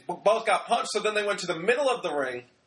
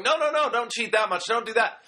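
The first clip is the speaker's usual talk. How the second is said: higher-pitched urgent pleading, imitated; mock referee protest; 6 semitones higher than usual. Referee telling two cheating teams to stop, mock protest. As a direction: play a flustered ref breaking it up.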